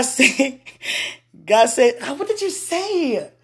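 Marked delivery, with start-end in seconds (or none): 2.0-3.3 higher, slow, mocking question; teasing God voice. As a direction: tease like a knowing friend.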